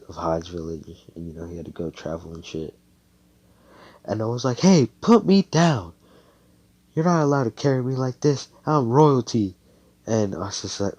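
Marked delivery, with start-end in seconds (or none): 4.6-9.6 high, loud, acted demand; indignant protest. Noelle being carried by Asta, indignant protest. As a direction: protest hotly, pitched up.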